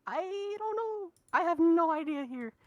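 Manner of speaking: high-pitched, drawn-out bafflement; stumped. Real, not acted. Commentator stumped over the next draft pick, baffled. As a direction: throw it up high, totally at a loss.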